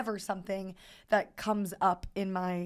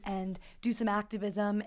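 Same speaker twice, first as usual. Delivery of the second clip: normal talking voice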